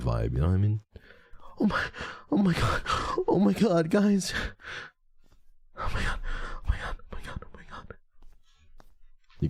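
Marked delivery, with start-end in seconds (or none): 1.6-4.3 high-pitched mock panic; badly faked fearful gasping words; pretend scared. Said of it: "I don't know how to be scared". 5.8-7.9 breathless whispered mock panic; fading fake-frightened whisper; pretend scared. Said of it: "I don't know how to be scared".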